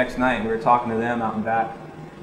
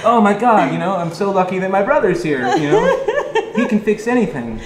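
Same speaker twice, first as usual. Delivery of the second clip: high, laughing, half-panicked relief; laughing mock panic; 6 semitones higher, 5 dB louder than usual. After the sink floods the bathroom, laughing with mock panic. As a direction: crack up while faking relief.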